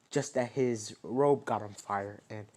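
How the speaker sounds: ordinary delivery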